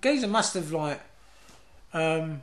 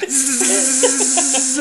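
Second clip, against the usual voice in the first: loud, high electrocution buzz; imitated electric buzz; 6 semitones higher, 5 dB louder than usual. Telling how Gary grabbed the fence, imitated electric zap.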